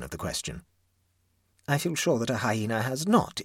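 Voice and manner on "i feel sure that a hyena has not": higher-pitched, unconvincing assurance; insist, but weakly; lame. A narrator's reading. Neighbour answers the hyena objection, lamely. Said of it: "she said lamely".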